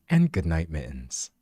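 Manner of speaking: low bedtime narrator, fading out softly; hushed end-of-story reading; audiobook voice. Shown as a demonstration. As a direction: let the last words sink into a soft close. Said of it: "I can literally do the audiobook voice".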